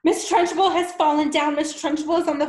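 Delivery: high, loud alarmed shout; screaming. Acted. Wilfred screaming to the class, alarmed shout.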